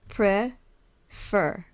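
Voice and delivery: "Prefer" split into pre, pause, fer; syllable by syllable. Shown as a demonstration. Teacher showing the syllable count, "Prefer" in two spaced parts. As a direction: say "Prefer" as two separate beats. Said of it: "Two syllables".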